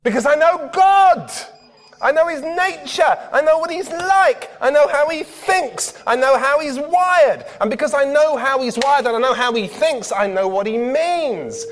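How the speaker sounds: a preacher's ringing testimony; fervent declaration. Real, not acted. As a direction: proclaim it with rising passion. Preacher declares knowing God.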